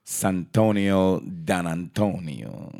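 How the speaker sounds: slow, drawn-out Italian name; mock Italian. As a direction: roll out the name like an Italian mobster. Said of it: "there we goes with the impressions again".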